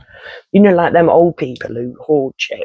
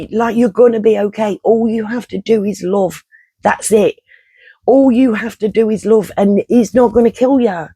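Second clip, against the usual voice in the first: pleading reassurance; 7 semitones higher than usual. Retold or imitated. In the dream, soothing panicked office workers, pitched-up earnest reassurance. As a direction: soothe a terrified crowd.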